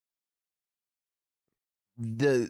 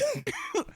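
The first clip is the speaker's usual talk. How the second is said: high squealing laugh; 6 dB quieter than usual. Real, not acted. Laughing while quoting kids.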